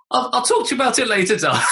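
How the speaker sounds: laughing deflection. Retold or imitated. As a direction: hurry past the question through laughter. Dad deflects in high voice.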